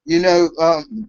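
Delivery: ordinary talk, nothing marked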